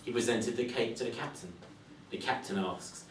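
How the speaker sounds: ordinary delivery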